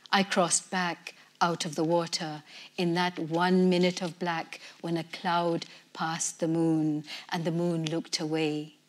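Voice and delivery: ordinary delivery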